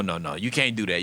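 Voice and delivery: ordinary delivery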